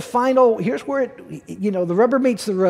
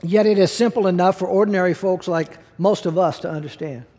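ordinary talk, nothing marked

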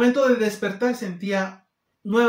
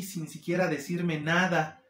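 ordinary talk

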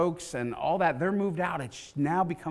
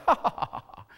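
high, scoffing imitation laugh; mock laughter; 7 semitones higher, faster than usual. Mourners laughing at Jesus, mocking. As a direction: act out a jeering crowd's laugh. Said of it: "can't imagine it's laughter like".

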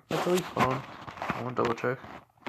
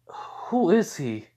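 slow wonder; 6 semitones higher, much slower than usual. Acted. Esdeath puzzled after he vanishes.

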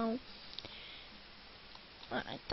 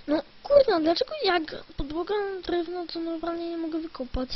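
frustrated outburst; 5 semitones higher, 6 dB louder than usual. Real, not acted. Frustrated when the block won't break.

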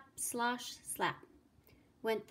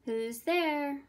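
lightly acted higher, louder call; 4 semitones higher, 5 dB louder than usual. A narrator's reading. Second little pig answering a knock, calling out. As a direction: call warily through a door. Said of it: "called the second little pig".

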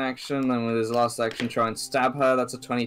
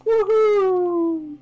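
loud, high cheer; excited whoop; 7 dB louder than usual. Real, not acted. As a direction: whoop with delight. GM cheers at roll.

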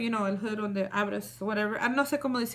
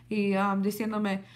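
ordinary talk, nothing marked

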